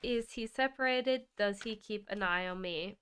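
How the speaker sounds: ordinary delivery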